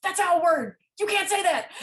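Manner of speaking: high, loud, laughing mock scold; teasing a friend who slipped, laughing mock outrage. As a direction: scold him in jest, cracking up. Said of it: "I'd be like".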